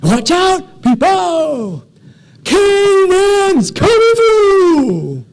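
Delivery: very high, drawn-out holler; huge top-of-the-lungs shout; yelling. Retold or imitated. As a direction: bellow a warning to clear the crowd. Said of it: "starts yelling out these words over and over again".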